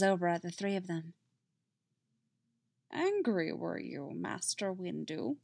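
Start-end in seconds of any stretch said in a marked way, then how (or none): 2.9-5.4 soft, slow Yoda question; quietly probing old master; lightly voiced Yoda. Yoda questioning Master Windu, softly.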